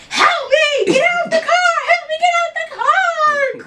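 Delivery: high-pitched re-enacted screams; screaming for help. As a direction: scream for rescue, stretching the last plea. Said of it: "I'm screaming".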